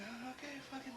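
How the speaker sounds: terrified hush. Retold or imitated. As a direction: shrink back, frightened of the angry dad.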